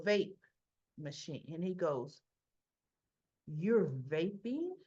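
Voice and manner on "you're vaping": slow, low, disbelieving question; imitating an appalled older brother; incredulous accusation. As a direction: draw it out in stern disbelief. Brother confronts boy over vape.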